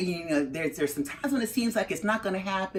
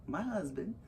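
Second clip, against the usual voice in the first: ordinary talk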